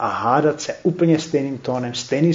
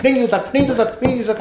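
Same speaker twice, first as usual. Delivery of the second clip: higher, louder insistent contradiction; mock quarrel retort; 9 semitones higher, 3 dB louder, much faster than usual. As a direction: fire back as the stubborn opponent. Second arguer retorts. (jako hádající se odpůrce, vyšším hlasem a hlasitěji)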